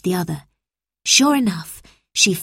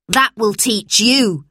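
slow, higher-pitched scolding; stern teacher's snap, measured out; snapped; 4 semitones higher, 4 dB louder, slower than usual. A narrator's reading. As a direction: scold a pupil sharply. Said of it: "she snapped".

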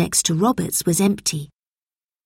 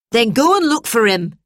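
higher, stern schoolmistress; strict teacher, curt; 5 semitones higher, 4 dB louder than usual. A narrator's reading. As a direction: order the pupil off firmly.

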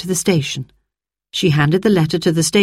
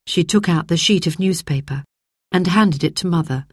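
ordinary delivery

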